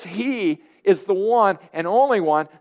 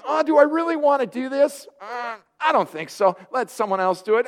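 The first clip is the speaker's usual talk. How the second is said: higher-pitched, hesitant excuse-making; hemming and hawing, pitched up; mock reluctance; 6 semitones higher than usual. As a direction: play a believer wriggling out of a chore.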